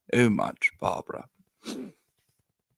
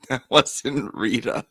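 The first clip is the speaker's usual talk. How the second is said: words squeezed out through laughter; giggling, half-choked answer; laughing sister; 8 semitones higher, 7 dB louder, slower than usual. Retold or imitated. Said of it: "And she was like".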